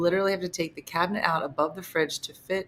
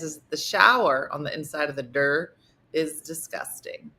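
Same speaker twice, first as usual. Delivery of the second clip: usual speaking voice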